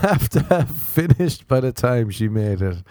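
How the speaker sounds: normal talking voice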